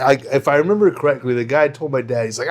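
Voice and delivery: normal talking voice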